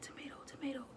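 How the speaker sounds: scared hushed calling; urgent whisper. Retold or imitated. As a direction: whisper her name so nothing hears. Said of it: "I'm like".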